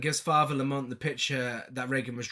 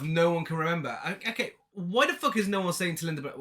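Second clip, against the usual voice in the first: ordinary delivery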